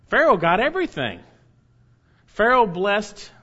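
emphatic. Real, not acted. Preacher stresses Pharaoh's blessing.

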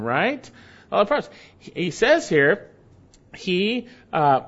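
ordinary talk

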